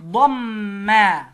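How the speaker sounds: ضما stretched very slowly and loud; very drawn out. Shown as a demonstration. Teacher stretching ضما for the child to copy. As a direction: draw ضما out syllable by syllable.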